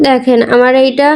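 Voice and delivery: ordinary delivery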